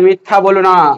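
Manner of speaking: ordinary delivery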